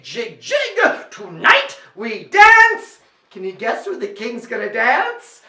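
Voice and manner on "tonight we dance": slow, high, loud declaration; king proclaiming. Acted. King announcing the masquerade, grand loud proclamation. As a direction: draw it out grandly.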